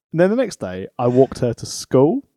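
ordinary talk

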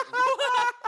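laughing disbelief. Retold or imitated. Laughing at movie.

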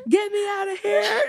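high, panicky joke voice; mock robot panic. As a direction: squeal it like a trapped robot. Joking robot assimilation.

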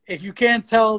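ordinary delivery

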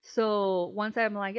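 ordinary talk, nothing marked

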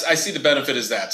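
ordinary talk

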